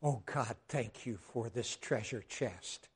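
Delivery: low, very soft, slow prayer; hushed prayer of thanks; reverent thanks. Acted. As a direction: murmur heartfelt gratitude to God. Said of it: "you just wept over this book".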